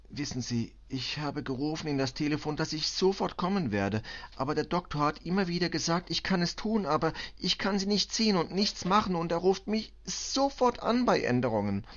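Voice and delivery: high, urgent account rising in agitation; helpless mother pleading to be understood; distraught foreign mother. Acted. Mother tells commissioner of the doctor's call, distraught and high.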